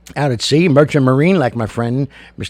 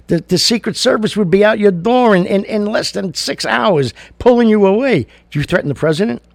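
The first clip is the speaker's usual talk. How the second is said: high, loud outrage; heated rant; 10 semitones higher, 6 dB louder than usual. Real, not acted. Host ranting to listeners, high and loud. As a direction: build up the outrage.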